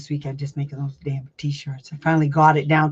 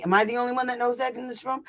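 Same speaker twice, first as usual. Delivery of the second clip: fast, higher sarcastic jab; smart ass; 7 semitones higher, 5 dB quieter, faster than usual. Shown as a demonstration. Parent mocking the room at the meeting, sarcastically. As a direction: needle them with sarcasm. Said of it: "This is when you become a smart ass".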